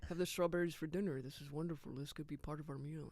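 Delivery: put-on soft, low French-accented murmur; romantic French couple. French couple admiring their strawberries, soft romantic accent. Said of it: "they're both so romantic".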